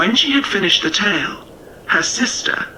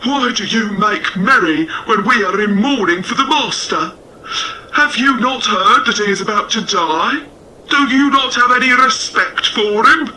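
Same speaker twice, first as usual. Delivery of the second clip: a touch higher, louder reproachful dog voice; reproachful, rising to higher and louder by the end; dogs scolding; 4 semitones higher, 3 dB louder than usual. A narrator's reading. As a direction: scold the cockerel like an upset farm dog. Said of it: "who said".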